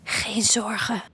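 soft whispered reassurance; a boy whispering comfort; tender whisper. Acted. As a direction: whisper it gently to the little dino. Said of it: "fluistert hij lief".

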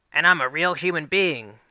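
hammed-up Natch Queen quote. Boy showing off to Miss Shelley, hammed-up Natch Queen voice. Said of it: "I say in the Natch Queen's voice".

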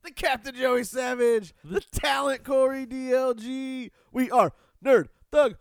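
high, loud, drawn-out hype intro; announcer voice. Pretend. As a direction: sell it like a wrestling ring announcer. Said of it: "bad Queen cover band".

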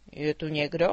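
low, loud dragon voice calling out; a big drake asks into the dark; dragon asking. A narrator's reading. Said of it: "zeptal se". (hlubší hlas draka)

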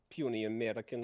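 a light Russian accent on puny Americans. Shown as a demonstration. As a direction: try a thick Russian villain accent. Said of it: "can you do a Russian accent".

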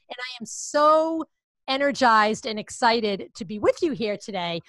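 ordinary talk, nothing marked

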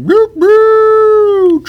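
high loud train whistle whoop. Pretend. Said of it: "That's the excuse train".

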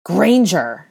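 hard, commanding shout; cold, hard bark. A narrator's reading. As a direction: summon her sharply and coldly. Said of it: "hard voice".

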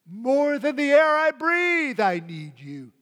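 high, loud impassioned prayer; preacher voices a worshipper's desperate cry; soul crying out. Acted. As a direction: cry out to God with longing. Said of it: "my soul crying out".

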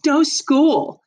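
gleeful high little cheer; excited. Acted. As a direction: cheer it like a delighted kid. Girl grins at this news.

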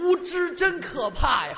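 mock scolding. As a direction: crow over his ignorance. Mocking partner's wrong answer.

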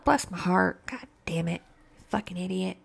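quiet annoyed self-talk; muttering to self. Retold or imitated. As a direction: grumble at yourself under your breath. Scolding herself.

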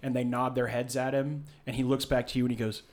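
usual speaking voice

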